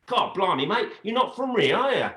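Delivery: high-pitched matey British accent. Shown as a demonstration. Switching accents to show off an impression, in a British accent. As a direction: jump up high into cheeky British banter. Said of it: "I'm pretty good at accents".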